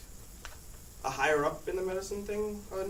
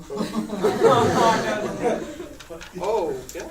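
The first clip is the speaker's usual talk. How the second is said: laughing oh; 5 semitones higher, 7 dB louder, much slower than usual. Retold or imitated. Reacts to sneeze warning.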